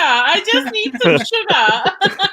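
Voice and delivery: put-on high pleading Australian accent, laughing; mock Aussie accent. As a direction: imitate Angela begging for sugar, Aussie accent. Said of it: "She kept saying".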